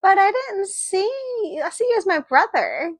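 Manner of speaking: high, startled huh then a rush; imitating her stunned reply to a confession; confused surprise. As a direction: jump up high, baffled, then quick. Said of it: "she's just like".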